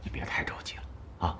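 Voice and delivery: soft, low, near-whispered reassurance; calm the student down quietly; gentle consoling. Acted. Consoling the student after refusal. (轻声安慰)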